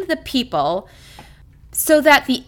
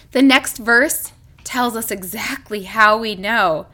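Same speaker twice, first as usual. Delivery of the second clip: usual speaking voice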